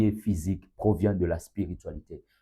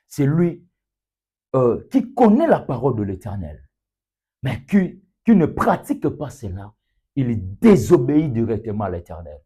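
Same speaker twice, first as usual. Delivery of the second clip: raised, high-pitched insistent preaching; emphatic preaching; 4 semitones higher, 6 dB louder than usual. Real, not acted. Preacher explaining who disobeys the Eternal, emphatic preaching. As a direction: press the point home loudly.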